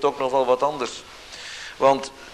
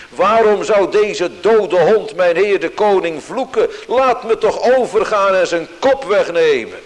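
a louder, higher outburst of indignation; verontwaardigd uitroepen; 7 semitones higher, 6 dB louder than usual. Retold or imitated. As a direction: demand to go over and take his head. Abizei wants to kill Simei. (verontwaardigde, luidere uitroep van Abizei)

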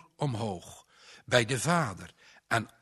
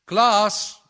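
a loud, higher call of the name; harder roepend; 10 semitones higher, 12 dB louder than usual. A narrator's reading. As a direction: shout it across the house, much louder. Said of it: "Harder roept hij". (luid, hoger geroepen)

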